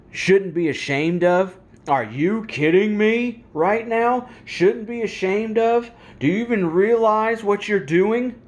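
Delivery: high, loud, indignant rant; angry outburst. Real, not acted. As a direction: fire the questions at her in real outrage. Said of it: "This stuff makes me so angry".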